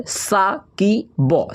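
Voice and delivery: Saqib stretched out slow for the class; slow dictation of Saqib; drawn out slowly. Shown as a demonstration. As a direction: draw out Saqib syllable by syllable. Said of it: "क्या लिखा हुआ है?".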